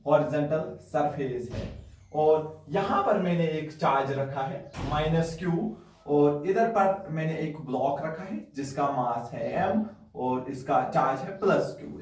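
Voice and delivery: ordinary delivery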